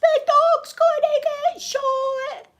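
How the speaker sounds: high, loud, slow hammed-up wail; mock scream. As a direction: wail it out in fake terror. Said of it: "I'm doing all that again".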